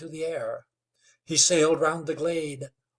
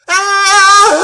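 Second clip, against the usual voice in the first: very high, very loud horrified shriek; shriek of terror; 19 dB louder than usual. A narrator's reading. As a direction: let out a piercing scream of horror. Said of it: "shrieked Girty".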